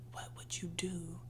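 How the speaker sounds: lying by candlelight, she whispers the question; whispered question. Acted. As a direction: whisper it as if to your inner self.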